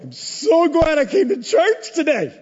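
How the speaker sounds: high, loud, sarcastic glad churchgoer; mock churchgoer. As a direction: play the listener's dry regret. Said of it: "you're like".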